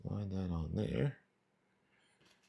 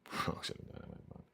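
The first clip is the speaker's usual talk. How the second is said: whispered repeat; 10 dB quieter than usual. Real, not acted. Repeating his simile to himself, whispered. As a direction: whisper Frogs in a dynamite pond, much softer.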